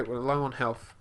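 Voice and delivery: ordinary talk, nothing marked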